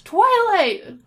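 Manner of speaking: high, loud cry of Twilight; brash high-pitched holler. A narrator's reading. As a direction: burst in shouting a friend's name. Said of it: "cried the".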